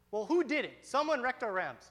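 loud, high accusing retort; acted-out outraged kid; indignant protest. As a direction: argue back, demanding to know who. Kid argues back at neighbor.

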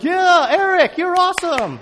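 imitating the kids hyping him up; cheering kids.

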